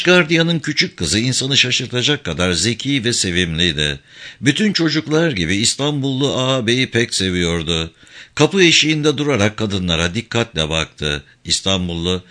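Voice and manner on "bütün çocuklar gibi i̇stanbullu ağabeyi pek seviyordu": usual speaking voice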